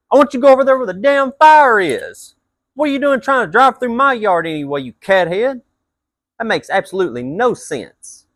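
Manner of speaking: loud, high, furious telling-off; yelling at a driver tearing up his yard, high and loud; angry yell. Retold or imitated. Retold yelling at truck driver.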